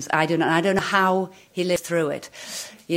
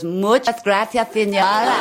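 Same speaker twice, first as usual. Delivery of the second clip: put-on slow, slightly raised Spanish accent; mock Spanish accent; 3 semitones higher, 4 dB louder than usual. Beggar's punchline to the leader, mock Spanish accent. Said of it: "And he says".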